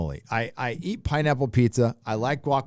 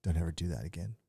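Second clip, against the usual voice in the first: hushed, urgent whispered warning; imitating whispering Texans; 5 semitones lower, 13 dB quieter, faster than usual. Texans behind him warning him off saying y'all, whispered. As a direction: whisper it like a worried local. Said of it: "I can hear Texans back there going".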